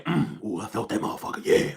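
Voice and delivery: low breathy voicing of the man's pleasure; mock pleased groan. Voicing the man in the video, a low mock pleased murmur. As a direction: whisper it low like he enjoyed it. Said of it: "Don't he look like he's saying".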